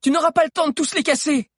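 loud, very high urgent warning; high-pitched alarmed cry; shouting. A narrator's reading. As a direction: yell to make them give up and hurry. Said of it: "cria Alix".

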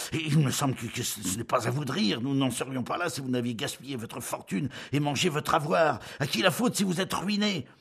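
high, loud stammering fury; shaking with anger. Acted. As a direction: stammer it out trembling with rage. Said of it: "il balbutia d'une voix tremblante de colère".

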